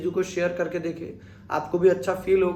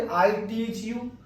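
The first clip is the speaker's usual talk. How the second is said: very slow, word by word I teach you; slow model sentence; 4 dB quieter, much slower than usual. Shown as a demonstration. Teacher slowly modelling I teach you for students. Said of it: "तो मैं क्या बोलूंगा, देखिए".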